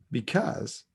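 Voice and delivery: ordinary talk, nothing marked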